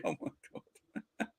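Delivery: hushed aside while laughing; whispered disbelief. Real, not acted. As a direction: mutter it under your breath in disbelief. Embarrassed at Bruno donkey picture.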